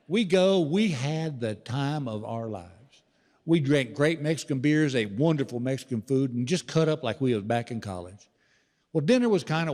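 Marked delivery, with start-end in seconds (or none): none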